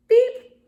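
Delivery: loud high censor beep; bleep sound. Real, not acted. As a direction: beep over the swear word. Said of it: "I'm not gonna read it".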